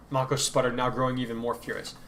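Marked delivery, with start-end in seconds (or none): none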